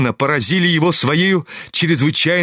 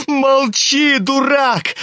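much higher, urgent outcry; high-pitched shouting; 8 semitones higher, much slower than usual. A narrator's reading. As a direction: cry out to shut him up. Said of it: "кричал Коля".

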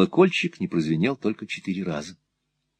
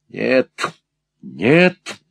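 angry refusal; 4 semitones higher than usual. Acted. Furious refusal during the struggle.

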